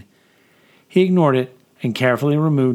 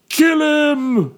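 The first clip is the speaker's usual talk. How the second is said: loud, high-strained mob shout; bellow; 5 dB louder than usual. Acted. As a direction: bellow it over the crowd as an enraged man. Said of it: "bellowed one man".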